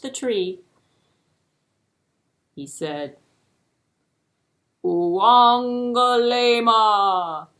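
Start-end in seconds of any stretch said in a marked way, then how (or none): 4.8-7.5 slow, loud, triumphant call; loud and clear. Acted. Tortoise calling the name to the tree, loud and clear. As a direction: proclaim the name slowly and loudly. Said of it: "loud and clear".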